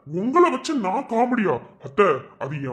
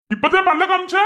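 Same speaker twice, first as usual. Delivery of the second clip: mocking shriek; 11 semitones higher, 4 dB louder than usual. Mocking retort in family quarrel.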